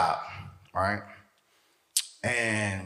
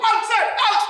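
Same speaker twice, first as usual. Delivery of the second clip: very high, insistent hollering; acted yelling; faster than usual. Cousin yelling at what he thinks is Alex, high hollering. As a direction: holler the name in high bursts. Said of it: "he yelling at the remote".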